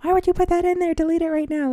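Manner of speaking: mock scolding mother; a touch of nagging mom; imitating mom. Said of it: "see my mom shaking her head".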